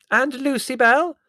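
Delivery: high, loud, slow and effusive; an effusive old aunt greeting; elderly aunt voice. A narrator's reading.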